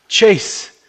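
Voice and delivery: high, very loud shout; urgent yell. Acted. Friend yells to rouse Chase in flooding car, urgent yell. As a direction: yell the name in alarm. Calling out after the crash.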